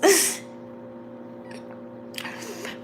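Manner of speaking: airless high-pitched laugh; high breathy laugh. Real, not acted. Owning up to lazy reading, a high breathy laugh. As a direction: crack up silently at herself. Laughing at her own laziness.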